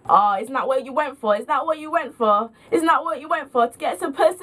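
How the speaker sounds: imitated drunken sing-song jeer; tipsy friend taunting. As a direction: needle them relentlessly, pushing up to "pussy". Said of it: "so much emphasis on the word pussy".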